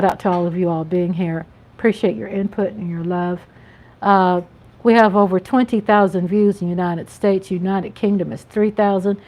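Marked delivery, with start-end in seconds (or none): none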